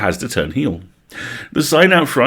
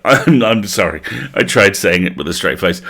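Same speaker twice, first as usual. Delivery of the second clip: ordinary delivery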